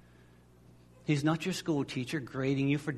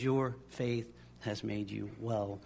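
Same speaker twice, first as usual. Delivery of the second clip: gentle blessing; 6 semitones lower, 9 dB quieter, slower than usual. Retold or imitated. Jesus answers the healed woman.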